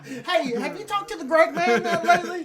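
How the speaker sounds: very high, loud silly caller voice; hammed-up phone caller. Pretend caller asking after the Greg man, hammed-up and high. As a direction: put on a squeaky mock caller.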